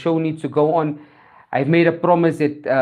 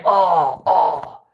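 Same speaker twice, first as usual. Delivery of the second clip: drawn-out mock groans; acted dying groan. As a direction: groan like a dying frog in a tale. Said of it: "And the frog is dying".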